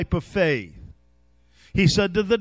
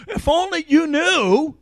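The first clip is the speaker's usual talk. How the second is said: raised, louder voice of the Lord; impassioned appeal; 5 semitones higher, 5 dB louder than usual. Acted. The Lord appealing to believers, impassioned and loud. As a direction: cry it out as an urgent plea.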